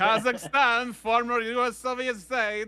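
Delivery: high mock Kazakh accent; Borat. Riffing on the Borat-y voice, high Borat impression. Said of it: "became a bit Borat-y there".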